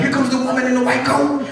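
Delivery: mock alarm, pitched high; alarmed mice. Mice warn each other about the woman, mock alarm. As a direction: spread a high-pitched warning. Imagined mice warning one another.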